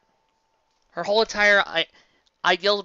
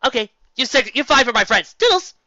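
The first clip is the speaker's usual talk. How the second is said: rushed, louder, ending in a high toodles; hasty Twilight; 5 semitones higher, 6 dB louder than usual. Retold or imitated. Twilight declaring five friends then leaving, brisk and chirpy. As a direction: tick off friendship as a chore and dash away. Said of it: "if you tell her to make friends".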